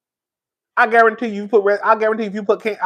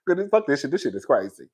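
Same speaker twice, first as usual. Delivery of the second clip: muttered frustration; 5 semitones lower, 8 dB quieter than usual. Retold or imitated. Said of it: "I'm like".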